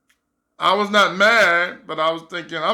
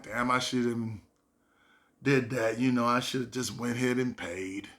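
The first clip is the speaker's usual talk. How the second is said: quiet regret; 6 semitones lower, 9 dB quieter than usual. Retold or imitated. Thinking to himself with regret.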